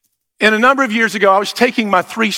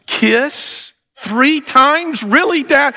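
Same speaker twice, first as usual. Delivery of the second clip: teasing disbelief; 6 semitones higher, much slower than usual. Retold or imitated. Son teasing dad.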